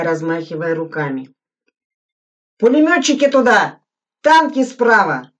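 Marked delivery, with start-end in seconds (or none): 2.6-5.3 loud, higher commands across the field; shouted orders. A narrator's reading. Suslin directing gunners and anti-tank men, loud shouting. Officer directing troops in drill.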